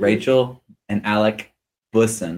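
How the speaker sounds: measured, separated names; slow down and place each name carefully. Shown as a demonstration. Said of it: "I will say it slower now".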